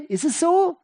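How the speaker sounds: "Ist es so" pitched high as a challenge; high-voiced prompt to the congregation; preacher's question. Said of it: "als Frage".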